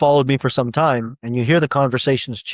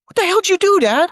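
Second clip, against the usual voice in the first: put-on high, loud astonished teen yelp; a teenager blurting out in disbelief at his dad; much higher, louder amazed outburst; stunned son; 5 dB louder than usual.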